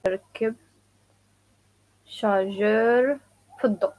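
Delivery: "ركب", "الشارجور", "في الضوء" each set apart; slow chunked drill; word by word, slow. Shown as a demonstration. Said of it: "some people say also".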